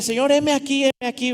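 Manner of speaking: put-on young volunteer eagerly offering himself to God. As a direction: throw yourself forward, ready to go.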